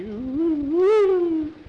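long, high, loud wail; dismayed "ooh". A narrator's reading. Skeeter reacts to double dose threat.

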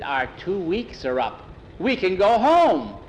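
high, loud and slow, savoring it; excited boy. Acted. Boy telling his friend they can go home, slow and thrilled. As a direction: draw out the joy of going home.

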